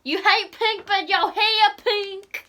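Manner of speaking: loud, slow teasing call-out. Real, not acted. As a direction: tease her loudly and slowly. Teasing friend.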